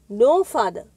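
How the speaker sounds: determined. A narrator's reading. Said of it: "the young man replied with determination".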